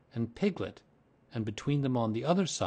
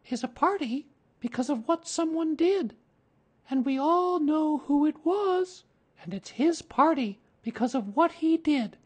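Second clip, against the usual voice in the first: put-on boy's speech; 5 dB louder than usual. A narrator's reading. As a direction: announce it like a child addressing a party. Boy making a speech.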